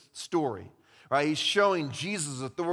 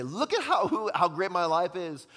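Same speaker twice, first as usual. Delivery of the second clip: mock bragging; 3 semitones higher than usual. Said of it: "and say".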